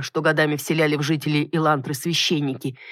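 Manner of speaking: usual speaking voice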